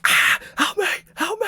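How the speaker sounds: shrill falsetto screaming for help; mock scream. Screaming down the phone at a scam caller, mock panic. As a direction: scream for help like you're being attacked. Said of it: "start screaming down the phone".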